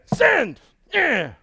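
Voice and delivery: high, loud triumphant shout; gleeful shout. Retold or imitated. Preacher acting someone hitting send in triumph, loud and high. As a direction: fire it off with a whoop. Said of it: "you're like".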